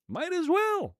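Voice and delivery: very high, silly echo of the phrase; squeaky high repeat. Pretend. Streamer joking about getting a manager, silly falsetto.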